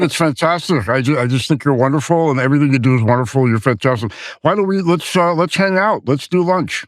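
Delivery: host's pal does his Spielberg, all flattery and lunch invites; Steven Spielberg impression. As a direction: gush like Spielberg flattering a friend. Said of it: "I'll do my Steven Spielberg impression".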